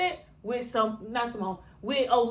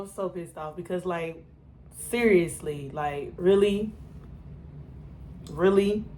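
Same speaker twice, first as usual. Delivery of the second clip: normal talking voice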